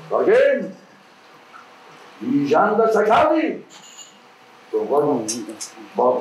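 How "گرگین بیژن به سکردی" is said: angry accusation. Acted.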